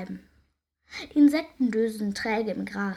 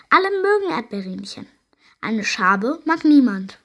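calling out; 8 dB louder than usual. A narrator's reading. Heidi explains to the gathering, loud call. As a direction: call it out to all fairies. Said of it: "ruft sie".

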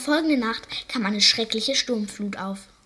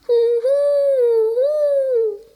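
very high, loud hammed-up howl; the seal wailing hu hu hu for the lost bird; seal's howling sob; 10 dB louder, much slower than usual. A narrator's reading. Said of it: "heulte der Seehund".

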